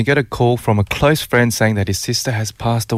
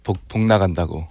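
fast Korean saying; 4 semitones lower, 4 dB quieter, much faster than usual. Retold or imitated. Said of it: "I'll say it in the Korean way".

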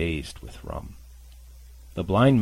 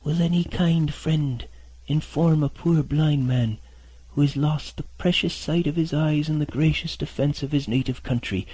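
high, pleading beggar's whine, louder; whining blind beggar; 8 semitones higher, 4 dB louder than usual. Acted. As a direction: beg pitifully like a blind old sailor. Blind beggar asks for help.

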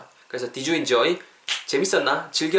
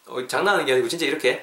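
normal talking voice

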